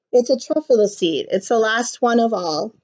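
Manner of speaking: usual speaking voice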